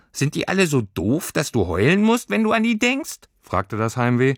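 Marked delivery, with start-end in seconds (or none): 0.1-3.2 high, rising creature voice; high incredulous creature; baffled creature. Acted. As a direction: creature amazed anyone cries. Heimweh voice.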